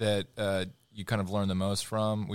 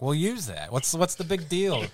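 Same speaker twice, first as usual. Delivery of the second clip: mock exasperation; 8 semitones higher than usual. Producer answering a whining actor, mock exasperated. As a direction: act baffled at a whiner, pitch climbing.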